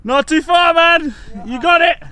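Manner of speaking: loud, high cheering on; encouraging shout. Real, not acted. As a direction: yell encouragement down the path. Cheering on climber below.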